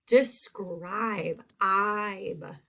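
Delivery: "Describe", then just the ending "ibe"; long I isolated. Shown as a demonstration. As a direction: echo the long I end of "Describe". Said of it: "That's a long I sound".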